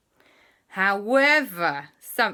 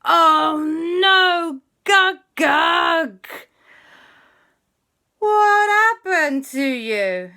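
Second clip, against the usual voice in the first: shocked wail; 10 semitones higher, 9 dB louder, much slower than usual. Acted. Family shocked at tiny Gug-Gug.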